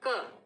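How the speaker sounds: short क with a touch of ख from the throat; clipped क, a bit breathy from the throat; phonics c sound. Shown as a demonstration. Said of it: "वो होती है". (गले से हल्का ख मिला क)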